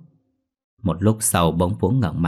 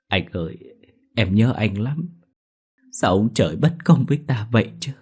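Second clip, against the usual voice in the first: sobbing; 3 semitones higher than usual. A narrator's reading. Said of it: "cô khóc nức nở".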